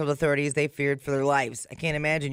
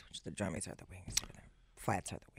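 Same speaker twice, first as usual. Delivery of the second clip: hushed unvoiced aside; whispering; 7 semitones lower, 15 dB quieter, faster than usual. Real, not acted. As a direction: whisper it to yourself.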